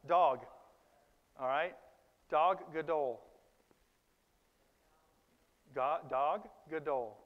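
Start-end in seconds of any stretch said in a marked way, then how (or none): 0.1-0.5 "Dog" said loud as Hebrew dag, with an open "ah" vowel; a teacher's loud model of "Dog" for the class to repeat; Hebrew pronunciation. Shown as a demonstration. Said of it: "Can you try it?". 2.3-7.2 "Dog gadol" said slowly as Hebrew dag gadol, stressing the second syllable -dol; Hebrew words. Shown as a demonstration. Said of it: "Dog gadol means great fish in Hebrew".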